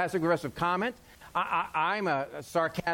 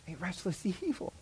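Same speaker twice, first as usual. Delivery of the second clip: softer, with a chuckle on evil; laughing softly; 8 dB quieter than usual. Shown as a demonstration. Pastor repeating a verse to himself, quietly amused. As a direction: savor the phrase, half laughing. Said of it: "repeated phrase, plain then marked".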